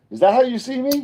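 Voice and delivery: high, loud incredulous question; surprised. Retold or imitated. Asking his friend's wife, high and stunned. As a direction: squeak it out, caught off guard. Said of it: "And I was like".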